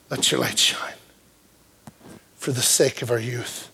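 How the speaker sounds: low, soft closing plea to the congregation; quiet sermon appeal. Acted. Preacher urging the church to support its youth, low and hushed. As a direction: draw them in, earnest and hushed.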